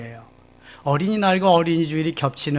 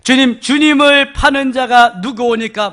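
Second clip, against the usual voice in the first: raised question; 8 semitones higher, 10 dB louder than usual. Acted. Disciple's question read aloud.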